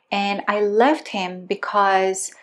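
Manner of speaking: ordinary talk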